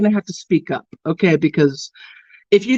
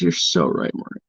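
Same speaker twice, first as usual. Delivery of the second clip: low mock agreement; 8 semitones lower than usual. Answering Maureen.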